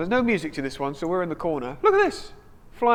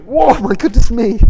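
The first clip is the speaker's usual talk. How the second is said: surprised; 3 semitones higher, 14 dB louder, slower than usual. Real, not acted. A handful of biscuits thrown.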